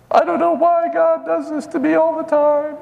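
high whining complainer voice; whiny brat. Retold or imitated. Preacher mocking whiny believers to congregation, high whiny voice. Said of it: "You become just a whiny little brat?".

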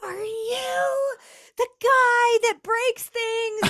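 put-on high, drawn-out little-kid voice; little boy. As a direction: imitate an awestruck small boy, slowly and high. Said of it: "a little boy comes up to our table".

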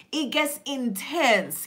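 normal talking voice

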